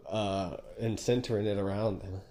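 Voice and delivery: ordinary talk, nothing marked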